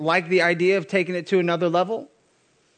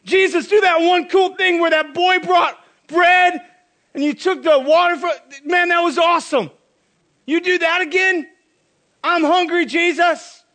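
loud, higher pleading crowd voice; begging crowd; 8 semitones higher, 5 dB louder than usual. Acted. Crowd begging Jesus for more miracles, loud and eager. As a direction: nag him like an eager crowd.

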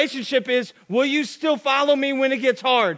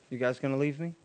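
softer, lower and hushed; quiet hurt question; 8 semitones lower, 10 dB quieter, faster than usual. Acted. Jesus quietly asking the twelve if they'll leave. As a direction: ask it gently, almost hurt.

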